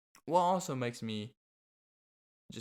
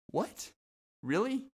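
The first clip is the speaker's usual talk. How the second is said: What and Really pitched much higher in sarcastic shock; mock disbelief; 10 semitones higher than usual. Vlogger reacting to clueless parents, sarcastic mock surprise. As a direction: act fake amazed, dripping with sarcasm.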